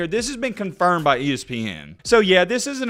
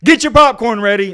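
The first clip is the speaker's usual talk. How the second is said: loud hyped quote of Get your popcorn ready; 3 semitones higher, 8 dB louder than usual. Retold or imitated. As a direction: belt it like a fired-up coach. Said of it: "Is that what Lane Kiffin said".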